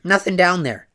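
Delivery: high, loud curt crank voice; crank woman. A narrator's reading. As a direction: dismiss the spot sharply.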